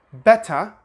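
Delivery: "better" with a clear T; pronounced T. Shown as a demonstration. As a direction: say "better" as "beh-tuh". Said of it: "Some British people say better".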